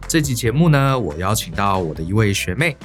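ordinary talk, nothing marked

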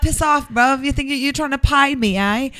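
high, hammed-up Essex accent; mock indignation in an Essex accent; put-on Essex accent. Telling off a Lyft driver in a put-on Essex accent. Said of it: "I have an accent now".

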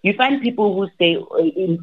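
normal talking voice

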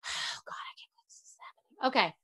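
real exasperated whisper after a sigh; whispered dismay. Real, not acted. Host lamenting the dress disaster, whispered dismay. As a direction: whisper it to yourself in disbelief.

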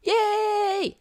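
excited cheer. Real, not acted. Cheering for the fun segment.

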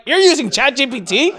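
high, incredulous question; can't believe what he hears; mock incredulous question.

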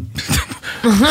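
breaking into a giggle; giggle, can't keep it in; high giggle. Real, not acted. Cracks up mid-reading.

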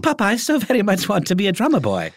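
put-on high childish British accent asking father; British schoolboy voice. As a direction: plead like a small boy from an English boarding school. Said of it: "And I love that voice".

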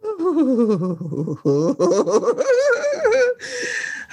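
long high cackle that nearly turns to crying; laughing helplessly, high and breathless; high laughing fit. Real, not acted. Laughing hard at her co-host's joke, high laughing fit.

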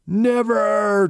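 high, loud cry of defiance; defiant shout. A narrator's reading. Troll charging the witches, loud defiant cry. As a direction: yell it as you charge. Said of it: "Troll said as he charged".

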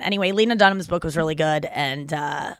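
ordinary talk

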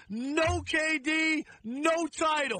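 each word "No K D, no title" laid down slowly; slow emphatic slogan. Real, not acted. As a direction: spell it out slowly like a verdict. Hammering his point in debate.

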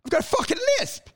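rising, high-pitched panic; nervous self-doubt. Acted. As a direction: fret suddenly about your own voice. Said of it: "when you're nervous".

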